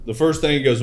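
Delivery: ordinary talk, nothing marked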